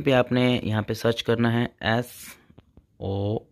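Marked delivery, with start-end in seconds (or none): none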